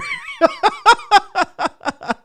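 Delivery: long high-pitched laugh; high laughter. Real, not acted. Cracking up at his own rant, high laughter.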